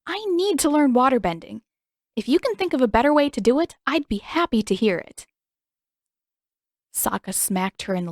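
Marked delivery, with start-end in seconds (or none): 0.1-5.2 higher, louder, fast heated reply; heated retort. Acted. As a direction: fire it back at him. Katara angrily retorting.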